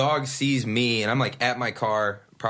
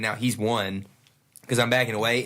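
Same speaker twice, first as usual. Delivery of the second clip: ordinary talk, nothing marked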